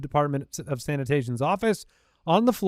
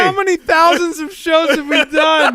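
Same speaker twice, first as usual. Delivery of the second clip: loud; 10 semitones higher, 5 dB louder than usual. Real, not acted. Loud laughing disbelief.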